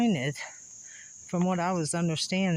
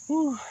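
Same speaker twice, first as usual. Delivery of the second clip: sharp high whew of relief; winded whew; 10 semitones higher, 4 dB louder than usual. Real, not acted. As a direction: blow it out after the hike. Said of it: "Sorry about the breathing hard".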